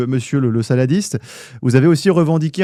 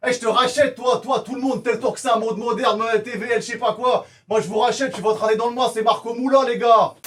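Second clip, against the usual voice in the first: high-pitched rant; 9 semitones higher than usual. Acted. Rants and threatens the crew.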